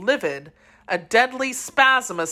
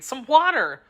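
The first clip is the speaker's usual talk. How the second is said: urgent cry; 7 semitones higher than usual. A narrator's reading. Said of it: "cried D'Artagnan".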